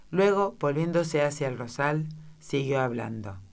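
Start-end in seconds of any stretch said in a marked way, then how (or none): none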